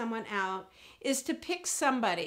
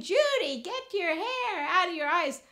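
high-pitched insistent mother voice; mother act-out; 6 semitones higher than usual. Shown as a demonstration. Mother telling Judy about her hair, high and insistent. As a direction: play Mom nagging her daughter. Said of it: "I'm become my mother".

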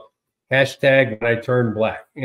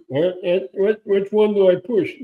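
a lightly voiced baffled voter, higher and slow; confused voter; 7 semitones higher, slower than usual. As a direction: play a lost voter staring at the ballot. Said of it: "You see a maga person".

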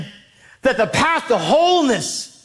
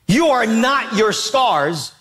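ordinary talk

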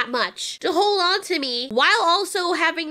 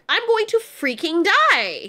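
higher, drawn-out imitation of a panicking girl; mock panic; 4 semitones higher, slower than usual. Recapper imitating the girl on the falling ladder, mock panic. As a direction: stretch it out slowly in hammed-up dread. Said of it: "this girl is like".

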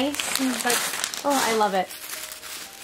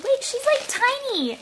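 much higher, amazed squeal; surprised squeal; 12 semitones higher than usual. Real, not acted. Finding the tiny belly plate, high surprised squeal.

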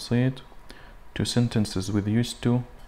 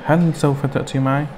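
"هل سوف تأتي معي؟" pitched up as a question; question intonation; 4 semitones higher, 4 dB louder than usual. Shown as a demonstration. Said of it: "يعني لما بقول". ("هل سوف تأتي معي؟" بنبرة سؤال مرتفعة)

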